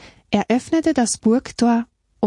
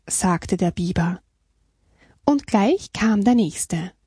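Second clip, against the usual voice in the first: usual speaking voice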